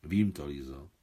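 low, weighty admission; slow and heavy. A narrator's reading. Tatiana Sergejevna admits she knows of the love, heavily. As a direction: say it heavily, pitch dropped low. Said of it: "řekla velmi pomalu a těžce".

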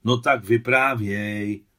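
loud, drawn-out coaxing; insistent pressing. A narrator's reading. Boy presses Adam for a war story, insistent. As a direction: push him to tell, slow and loud. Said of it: "tlačil na něj Miťka".

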